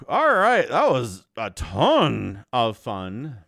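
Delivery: ordinary talk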